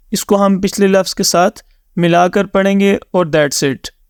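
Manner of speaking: normal talking voice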